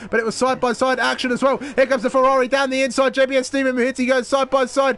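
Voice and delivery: high, loud excited play-by-play; motorsport commentator. Acted. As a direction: shout the wheel-to-wheel action. Side by side battle call.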